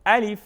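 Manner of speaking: الف said short with a clear final f; correct quick alif. Shown as a demonstration. Teacher showing the letter to learners, short clear الف. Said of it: "جلدی پڑھیں گے".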